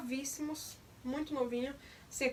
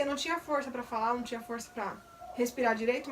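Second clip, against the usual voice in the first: usual speaking voice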